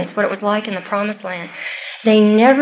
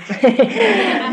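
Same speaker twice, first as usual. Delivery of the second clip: real laugh; 9 semitones higher than usual. Real, not acted. Laughs at own aside.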